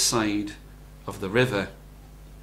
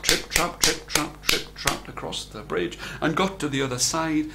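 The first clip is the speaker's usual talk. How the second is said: ordinary delivery, nothing marked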